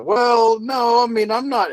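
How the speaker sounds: sudden high, loud startled protest; blurting it out, caught off guard. Real, not acted. Reacting to a chat comment, startled and loud.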